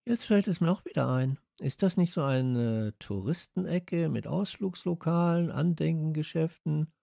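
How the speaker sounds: high-pitched character voice; Radieschen remembering. A narrator's reading. Child remembers the tourist spot, high-voiced. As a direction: recall it aloud in a high voice.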